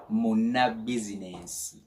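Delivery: ordinary talk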